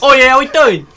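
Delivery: high, loud mock-Cockney greeting; Cockney accent. Friend joining the pretend podcast, loud Cockney accent. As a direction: play a boisterous Cockney. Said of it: "podcast with four Cockney people".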